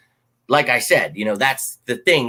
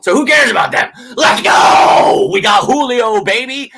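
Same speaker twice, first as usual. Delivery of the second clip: much higher, louder rallying shout; hyped up; 9 semitones higher, 7 dB louder than usual. Real, not acted. Cheering to fans about signing Julio, loud excited shout. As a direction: fire up the crowd with a big cheer.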